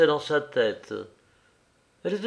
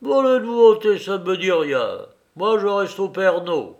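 higher, louder grumpy refusal; grumbling; 5 semitones higher, 5 dB louder than usual. A narrator's reading. Mimard, set in his habits, refuses a novelty, grumbling. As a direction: grumble it out loud, pitched up.

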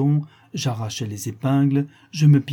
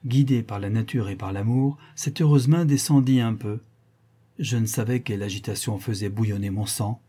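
ordinary delivery

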